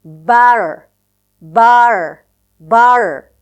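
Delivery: "butter" loud, the t flapped to "bara". Shown as a demonstration. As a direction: drill "butter" three times with a flap. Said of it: "fuse but and a to realize it as".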